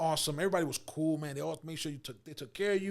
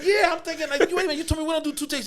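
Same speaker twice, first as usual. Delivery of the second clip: high, loud, fast protest; exasperated protest; 11 semitones higher, 8 dB louder, faster than usual. Retold or imitated. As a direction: complain in a rush.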